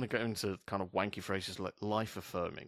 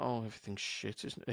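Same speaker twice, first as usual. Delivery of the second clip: gloomy; 8 dB quieter than usual. Acted. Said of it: "if someone's being like".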